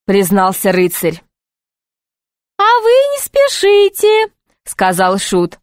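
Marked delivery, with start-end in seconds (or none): 2.6-4.3 very high, slow jester voice; high, drawn-out jester advice; soothing jester. Acted. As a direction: take it slowly in a piping jester pitch.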